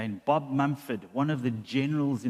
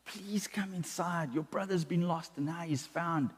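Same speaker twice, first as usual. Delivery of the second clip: pleading softly; 6 dB quieter than usual. Acted. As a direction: gently coax him back inside. Father pleads with older son.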